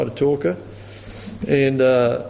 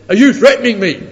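mock accusation; 6 semitones higher, 7 dB louder than usual. Atheist accuses, laughing retold.

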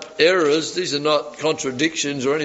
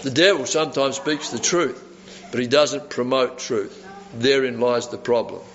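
normal talking voice

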